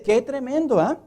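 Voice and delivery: usual speaking voice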